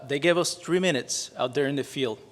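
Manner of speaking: ordinary delivery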